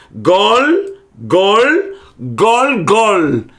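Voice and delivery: Gol repeated, each one clipped short; syllable drill. Shown as a demonstration. Teacher drills the two lamed syllables. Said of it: "Dois lâmedes, tá vendo?".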